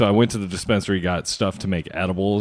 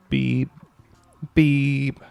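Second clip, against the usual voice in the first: a higher bleep sound done by mouth; mouth beep; 6 semitones higher than usual. Pretend. Host bleeps cohost's last name with a mouth beep. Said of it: "I'll beep your last name".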